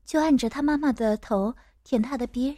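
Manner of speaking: usual speaking voice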